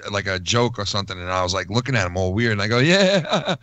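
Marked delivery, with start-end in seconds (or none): 2.8-3.5 higher, louder pretend-amused yeah; fake-laughing agreement. Coworker humoring a joke he missed, fake-laughing. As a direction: play along with a laugh you don't mean. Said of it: "I'm fake laughing at it".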